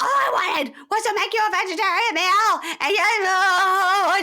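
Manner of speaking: high wailing shout, voice cracking; crying yell. Retold or imitated. Wife wailing at husband over cooking, crying yell. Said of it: "starts yelling about the cooking".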